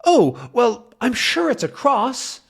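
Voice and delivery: high, doddery reply; high-pitched professor, cheerful; old professor voice. Acted. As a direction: answer like a deaf old man. Calculus replies.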